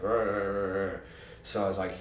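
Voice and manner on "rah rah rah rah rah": mumble jumbling. Retold or imitated. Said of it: "he was mumble jumbling".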